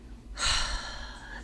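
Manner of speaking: long breathy exhale; deep sigh. A narrator's reading. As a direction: gather strength with a sigh. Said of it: "He takes a deep breath".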